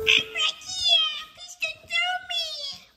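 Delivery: high, slow, begging little voice; pleading character voice. Pretend. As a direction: plead like a tiny creature. Kid voices a game character.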